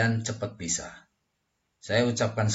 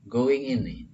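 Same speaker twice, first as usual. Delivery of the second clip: ordinary talk, nothing marked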